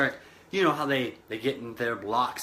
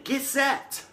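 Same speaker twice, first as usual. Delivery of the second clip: sharp, higher starter's call, mock yell; higher pitched mock shout of a race official; official's call; 10 semitones higher than usual. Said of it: "the official yells again".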